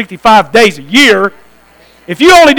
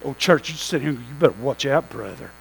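a low, knowing caution; low warning; 10 semitones lower, 15 dB quieter than usual. Real, not acted. Preacher warning the church brothers, low and menacing. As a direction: drop low and warn them, near a growl.

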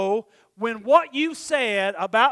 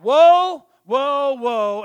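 mock lament fading; 4 semitones higher, 10 dB louder, much slower than usual.

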